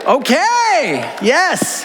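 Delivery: high excited shout to the crowd; excited call-out. Real, not acted. Pastor cheering a Storm fan in the crowd, excited shout. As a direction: whoop it up for the fans.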